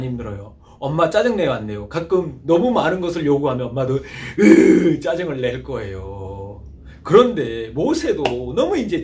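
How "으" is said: annoyed groan. Retold or imitated. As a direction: let out a mom's fed-up grunt. 짜증내는 엄마 흉내. (짜증난 엄마의 으 소리)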